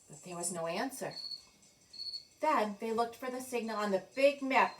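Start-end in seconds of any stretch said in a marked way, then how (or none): none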